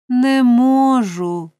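high, drawn-out moan; overwrought, stretched-out groan of distress. A narrator's reading. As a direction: wail it loudly and very slowly. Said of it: "простогнала господиня".

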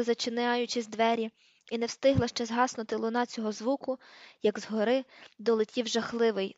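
ordinary delivery, nothing marked

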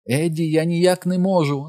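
high-pitched panicky wail; pleading, hammed-up high lament. A narrator's reading. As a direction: wail it like a distraught wife. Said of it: "залементувала вона".

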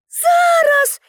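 high, bright-eyed eager shout back; eager call. A narrator's reading. Pippi agrees to bring the ladder, eager call. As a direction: pipe it up high, at once. Pippi answers policemen from below.

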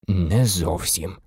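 low, slow, grave reply; deadly serious. A narrator's reading. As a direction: answer slowly and gravely. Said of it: "серйознішим, ніж будь-коли голосом". (дуже серйозно, низько й повільно)

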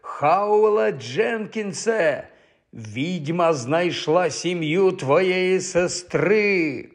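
very slow, drawn-out wailing call; howling. A narrator's reading. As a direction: howl the warning with long stretched vowels. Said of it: "завив він".

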